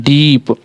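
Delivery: "डीप" with the ई stretched out; long drawn vowel. Shown as a demonstration. Teacher showing the voice should be pulled longer, long vowel. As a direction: draw out the middle of "डीप", then close on प. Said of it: "थोड़ा सा लंबा बोल रहे हैं".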